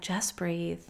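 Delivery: put-on calm yourself down like a little child; soothing self-talk. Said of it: "I'm talking to myself like I would a little child".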